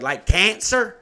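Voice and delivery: high, loud blurted question; loud child. Retold or imitated. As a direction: imitate a kid asking far too loudly. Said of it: "So loud".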